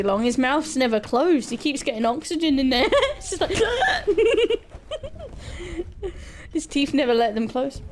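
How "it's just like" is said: words breaking into high laughter; laughing. Real, not acted. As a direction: try to talk but keep cracking up. Laughing at own joke.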